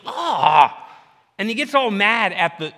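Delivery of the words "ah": sharp hurt cry as if hit in the lip; pained yelp. Retold or imitated. Preacher acts out a man hit by a wrench, with a sharp yelp. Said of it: "and he's like".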